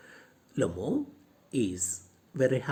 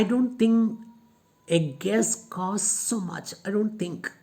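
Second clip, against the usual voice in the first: raised, higher mock-scoffing Natalia; 5 semitones higher, 3 dB louder than usual. Teacher voicing Natalia mocking the price, higher and scoffing. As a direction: scoff at the price of his dog. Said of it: "tells Lomo".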